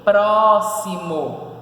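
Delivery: Próximo very slow and loud, pró, xi, mo; split into syllables. Shown as a demonstration. Teacher answering how it separates, Próximo syllable by syllable. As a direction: pull Próximo apart into long syllables. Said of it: "Se a gente for separar, fica o quê?".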